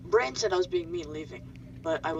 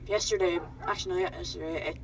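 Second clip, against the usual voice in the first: usual speaking voice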